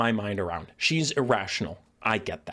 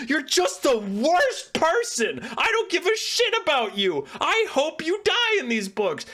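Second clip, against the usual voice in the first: mock petulant rant; 12 semitones higher than usual. Mock-voicing Ciri's petulant takeaway.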